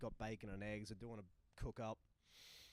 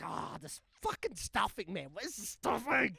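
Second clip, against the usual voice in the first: put-on frustrated drunk; 7 semitones higher, 8 dB louder than usual. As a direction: demand it, wasted and fed up. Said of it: "He's like".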